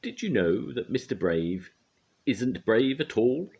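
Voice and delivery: gleeful taunt. A narrator's reading. Trouble exposing Mister Brave.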